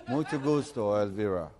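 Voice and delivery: put-on reluctant American-accented Spanish. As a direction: repeat it dutifully with American vowels. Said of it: "El americano".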